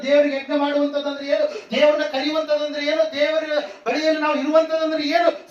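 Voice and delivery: high, insistent sermon delivery; fervent preaching. Acted. Preacher contrasting Ishmael's faith with his mother's, fervent preaching.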